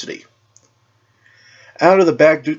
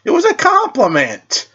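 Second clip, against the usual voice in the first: shrugging; 14 semitones higher than usual. A narrator's reading. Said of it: "Velocity shrugged".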